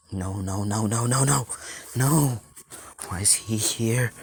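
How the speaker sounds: panicked, escalating denial; petrified. Acted. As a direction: repeat it in mounting dread. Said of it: "Nezu's petrified".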